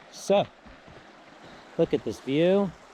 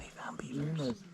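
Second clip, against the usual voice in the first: wildlife watching whisper; 9 dB quieter, slower than usual. Real, not acted. Watching beavers quietly.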